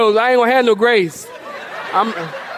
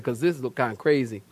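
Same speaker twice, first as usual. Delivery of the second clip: much softer, lower aside with a laugh; hushed laughing aside; 10 semitones lower, 9 dB quieter than usual. Retold or imitated. Husband whispering to his wife about the church, laughing softly. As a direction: murmur it, half laughing.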